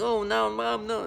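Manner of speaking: put-on high, pleading little child; imitating a scared kid. Child calls out to his mom in fear, pleading. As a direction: whimper it like a frightened toddler. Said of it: "the kid saying".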